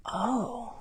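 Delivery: flat, soft "Oh"; deadpan. Retold or imitated. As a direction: say it completely deadpan. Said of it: "just deadpan".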